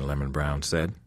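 ordinary delivery, nothing marked

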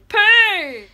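loud, high silly punchline; playful shout. Real, not acted. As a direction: belt out the silly word.

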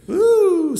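loud high falsetto ooh; a high, delighted whoop; excited ooh. Real, not acted. Cowboy smelling the pine inside, loud high ooh.

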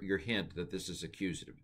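ordinary talk, nothing marked

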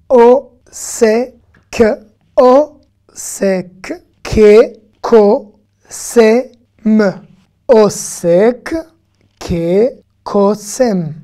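each syllable called out loud and separate; syllable drill. Shown as a demonstration. Teacher drilling Hebrew syllables for students, loud and clipped apart. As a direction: sound out each syllable slowly, one by one. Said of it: "teacher syllable drill".

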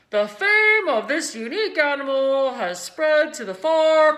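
loud, booming PA speech; fair announcer. A narrator's reading. Announcer praising the pig to the crowd, loud. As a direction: proclaim it to the grandstand. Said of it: "said the loudspeaker".

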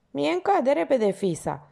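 grumbling. Acted. Porter grumbling to the young man, slow. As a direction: mutter it slowly and sourly. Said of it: "bodogăni".

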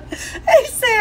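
high, laughing through words. Real, not acted. Wife retelling to husband, laughing. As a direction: break into laughter.

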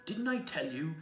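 high, loud, triumphant; told-you-so glee; delighted. A narrator's reading. Mister Beaver answers Peter on the climb, excitedly. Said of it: "almost dancing with delight".

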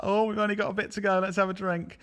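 lightly voiced, high and quick; a hint of a tipsy walker; cheery pub walker. Mimicking tempted walkers at pub.

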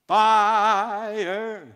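long, high, booming shout of fire; strong shout. Shown as a demonstration. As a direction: belt it out and stretch the word. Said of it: "fire just didn't sound strong enough".

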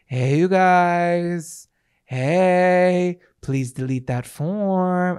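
very drawn-out, slow hey; sheepish call-back. Retold or imitated. Guest phoning staff after finding phone, sheepish. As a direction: stretch each greeting out, embarrassed to ask.

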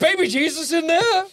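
high, hammed-up wonder; mock-amazed squeak of a question; awed onlooker. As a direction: ask it high, as if witnessing a miracle. Acts out witness at church.

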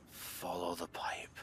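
whispering while hiding. Real, not acted. Hiding from the lady.

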